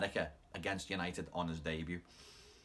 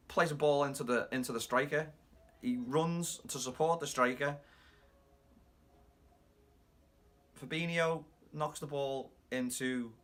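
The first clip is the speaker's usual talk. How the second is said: higher-pitched commentary, stalling mid-sentence; Gary Neville; 5 semitones higher than usual. Retold or imitated. Fan mocking a speechless pundit, halting commentator impression. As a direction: call the play slowly, halting with long pauses. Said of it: "I was just doing my best Gary Neville impression".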